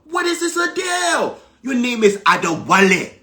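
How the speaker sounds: a father correcting his son's name; mock outraged dad shouting; Nigerian dad scolding. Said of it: "my dad was like".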